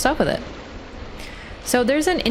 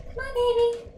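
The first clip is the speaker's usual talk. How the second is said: cooing to the cat; 3 dB quieter than usual. Real, not acted. Owner coaxing her crying cat over, high cooing.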